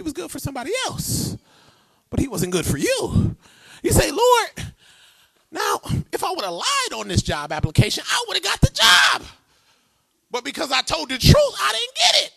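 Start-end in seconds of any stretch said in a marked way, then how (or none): 4.1-12.3 high, loud protest to God; mock complaining shout. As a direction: cry out at the Lord in mock frustration.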